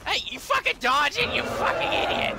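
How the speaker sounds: very high loud enraged yell; furious shouting. Real, not acted. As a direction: scream insults at the target. Yelling at dodging dragon.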